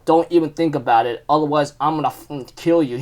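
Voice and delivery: low, quiet mock threat; retold warning. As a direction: act out a warning, dropped soft and low. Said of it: "he already warned Green Bull".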